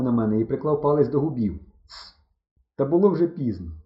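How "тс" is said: very soft shushing hiss; barely audible shh; hushing. A narrator's reading. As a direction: hush them secretly. Signal to keep silent.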